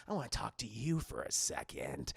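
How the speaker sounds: low, softened killer voice; menacing caller. Retold or imitated. Killer keeping her on the phone, creepy. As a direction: imitate a creepy stranger on the line.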